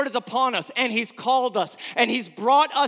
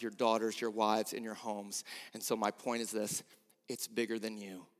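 much lower and softer, nearly breathless calm; hushed preaching; 12 semitones lower, 13 dB quieter than usual. Acted. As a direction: lean in and speak it gently. Quiet preaching passage.

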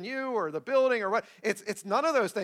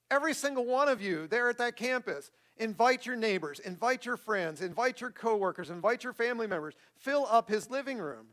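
normal talking voice

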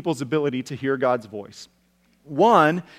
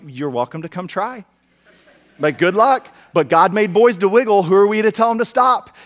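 playful challenge; 4 semitones higher, 5 dB louder than usual. Retold or imitated. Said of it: "And our invitation is always".

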